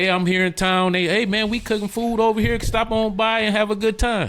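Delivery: hearty invitation; friendly greeting. Retold or imitated. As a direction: call out like an old friend inviting you. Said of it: "call somebody up and say".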